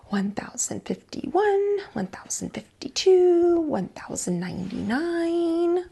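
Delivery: high, soft whispered counting of the years. Acted. Lily counts out her punishment in the chair, whispered. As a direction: count the years under your breath, slow and sulky.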